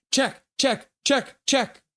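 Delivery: high-pitched, evenly spaced little chirps; staccato high-voiced repeats; high chirping. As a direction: pipe each word up high and separately.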